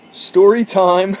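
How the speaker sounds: slow, loud showy intro; announcer-style. Shown as a demonstration. Vlogger retrying his intro as a showman. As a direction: drag it out like a show host. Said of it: "I'll try again".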